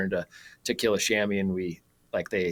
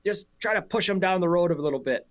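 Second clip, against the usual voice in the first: higher-pitched imitation New Zealand accent; 8 semitones higher than usual. Sheep farmer asking a driver for help, New Zealand accent. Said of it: "better than the sheep guy".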